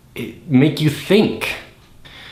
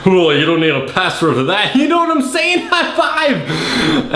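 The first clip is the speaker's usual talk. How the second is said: higher, laughing mock jock voice; hammed-up Ben; 7 semitones higher than usual. Ben jokes with Alex, hammed-up high laughing crow. Acting Ben's crude joke and high five.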